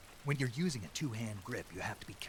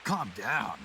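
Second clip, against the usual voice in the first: disbelieving, louder repeat of the word calm; incredulous echo; 3 semitones higher, 6 dB louder than usual. Acted. Snake repeating the paramedic's advice in disbelief. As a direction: throw the order back in disbelief.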